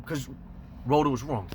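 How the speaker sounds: ordinary delivery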